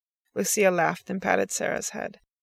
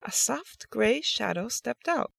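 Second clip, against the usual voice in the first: ordinary delivery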